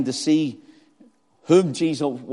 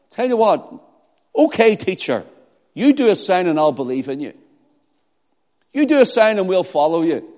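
loud, higher-pitched sarcastic dare; cynical and arrogant; 5 semitones higher, 6 dB louder than usual. Acted. Pharisees opposing Christ before the crowd, cynical and arrogant. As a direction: strike an arrogant bargain, mocking the teacher. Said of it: "cynical in their ways and arrogant".

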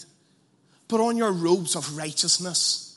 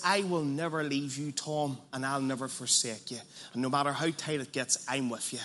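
tender promise; 5 semitones lower, 7 dB quieter than usual. Acted. Voicing the Lord's promise softly.

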